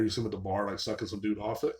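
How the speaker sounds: ordinary talk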